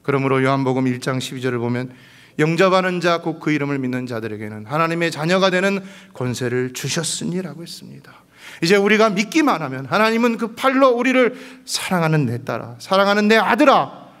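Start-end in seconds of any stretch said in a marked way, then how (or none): 2.4-7.6 loud, lifted scripture reading; preaching scripture. Real, not acted. 8.6-14.1 high, loud climactic cry; full preaching cry. Real, not acted.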